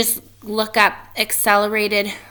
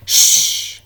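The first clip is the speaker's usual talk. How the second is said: shush; 6 dB louder than usual. Acted. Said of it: "said Badger suddenly".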